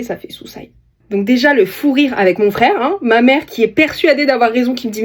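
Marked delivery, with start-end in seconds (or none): 0.0-0.7 "soussaille" said as soo-SIGH in an English accent. Shown as a demonstration. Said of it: "avec l'accent anglais".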